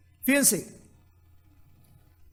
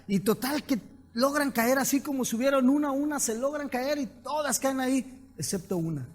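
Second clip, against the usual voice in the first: ordinary delivery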